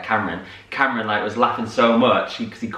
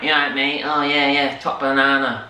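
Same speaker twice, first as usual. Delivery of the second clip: hammed-up Mancunian lad, a touch higher; a lightly put-on Manchester accent, mock matey; over-the-top Manchester accent; 4 semitones higher than usual. Castmates joking about the Manchester bit, a light over-the-top Mancunian accent. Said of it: "a really over-the-top Mancunian Manchester accent".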